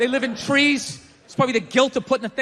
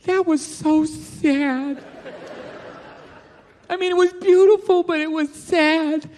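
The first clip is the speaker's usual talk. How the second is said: wife, sad; 3 semitones higher, slower than usual. Retold or imitated. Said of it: "she was like".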